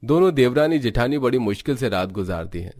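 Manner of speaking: usual speaking voice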